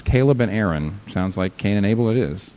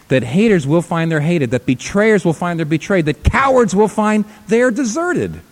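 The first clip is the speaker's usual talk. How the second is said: rising higher and louder to a ringing end; building preaching climax; 9 semitones higher, 5 dB louder than usual. Real, not acted. Preacher driving the congregation to a peak, building louder. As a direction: build each line up to a cry.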